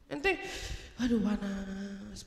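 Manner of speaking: lightly acted inner seething; mock seething. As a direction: drag it out like a simmering grudge. Heated by Satan's whispering.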